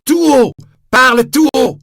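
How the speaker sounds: very loud and high shout; furious shout. Acted. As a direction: roar the order. Javert refusing a private word.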